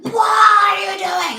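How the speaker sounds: loud, high, slowed-down mom shout; mom yelling. Retold or imitated. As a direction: yell it like a fed-up mom at her kid. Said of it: "like any other mom, you're like".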